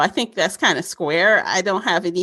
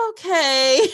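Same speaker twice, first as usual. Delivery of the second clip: resigned okay; 7 semitones higher than usual. Retold or imitated. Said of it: "So I'm like".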